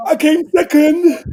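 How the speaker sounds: put-on high, slow whiny wail of a child; mock-sob it like a little boy who lost; imitating crying kid. Father imitating his bawling son after the race, high slow whine.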